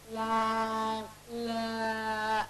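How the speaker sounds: "Lamb, la." with the L held long; prolonged L. Shown as a demonstration. Teacher showing prolongable sounds, L drawn out. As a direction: stretch the L of "Lamb" before the vowel. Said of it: "prolonging that".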